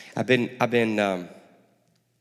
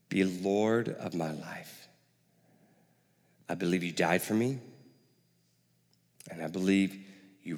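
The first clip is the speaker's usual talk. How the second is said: soft led prayer; 2 semitones lower than usual. Pretend. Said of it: "simply say".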